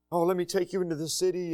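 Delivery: lightly mock a helpful schemer, hushed and quick; pretended sympathy. Manipulator luring mourners into the city, pretended sympathy. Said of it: "And he pretends in".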